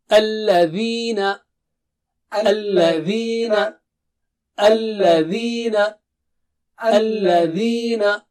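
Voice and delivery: alladhina, slow, with the tongue tip touching the upper teeth; slow careful alladhina. Shown as a demonstration. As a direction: hold the dh in alladhina. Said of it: "put the tip of the tongue to the edge of upper teeth".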